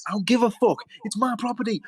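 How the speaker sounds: high, escalating yell; acted angry outburst. Property owner chasing her off, angrily shouting. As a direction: build to a loud, high FUCK OFF.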